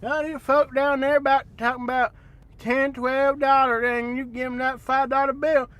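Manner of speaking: hammed-up down-home Alabama accent. Streamer griping as a local from down there, Alabama accent.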